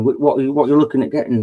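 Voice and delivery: usual speaking voice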